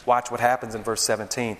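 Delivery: ordinary talk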